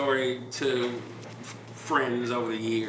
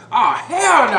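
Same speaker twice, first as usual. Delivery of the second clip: loud, high hammed-up protest; imitated indignant refusal; 13 semitones higher, 13 dB louder than usual. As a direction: act out a hothead friend refusing to back down. Hothead friend refusing to calm down.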